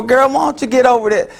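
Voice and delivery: high, impatient brush-off; dismissive advice. Acted. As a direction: tell her to move on, dismissive. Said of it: "Haven't you heard that before".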